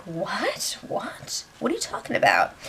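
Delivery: hushed, stunned "what?"; under-the-breath retold bafflement; baffled. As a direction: react softly in mild disbelief. Retold baffled reaction to offer.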